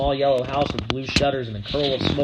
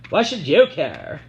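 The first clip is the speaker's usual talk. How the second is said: sulky retort; 4 semitones higher, 7 dB louder, slower than usual. A narrator's reading. Sissy throws words back.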